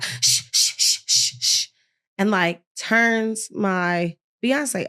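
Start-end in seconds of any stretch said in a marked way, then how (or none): 0.0-1.7 repeated whispered shushing. Retold or imitated. Nurse shushing the patient's music, whispered shh. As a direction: hush the room with insistent shh sounds. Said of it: "she's like".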